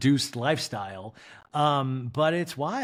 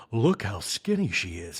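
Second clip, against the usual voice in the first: low slow mock voice; 6 semitones lower than usual. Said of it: "And Garcelle goes".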